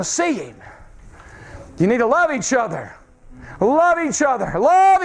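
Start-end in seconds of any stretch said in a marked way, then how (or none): none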